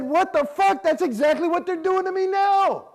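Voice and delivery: high, loud indignant outburst; outraged cry. Retold or imitated. Realizing the church does the same to him, high outraged cry. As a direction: blurt it out, stunned and furious.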